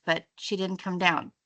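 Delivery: ordinary delivery, nothing marked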